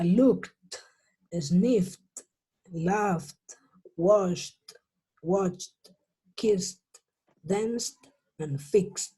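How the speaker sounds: word list from "looked" to "fixed", each ending in t; no e before the final t, "looked" as lukt. Shown as a demonstration. Teacher reading out the list, T endings. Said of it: "Todos con una T al final".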